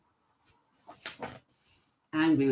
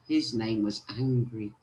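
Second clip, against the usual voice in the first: ordinary delivery, nothing marked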